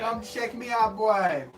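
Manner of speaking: put-on high Jamaican accent bragging. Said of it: "in his Jamaican accent".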